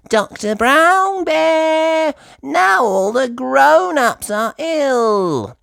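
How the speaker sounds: very high, slow urgent piggy voice; high drawn-out phone call; Peppa on phone. Acted. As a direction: call the doctor for help. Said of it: "she says".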